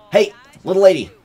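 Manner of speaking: high, loud fast hammed-up greeting; mock greeting. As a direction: play the smooth charmer. Greeting in a put-on voice.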